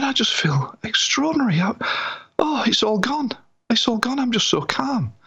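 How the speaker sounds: high, elated, breathless relief; overjoyed. Retold or imitated. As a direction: gush with wonder and relief. Relieved client voiced in story.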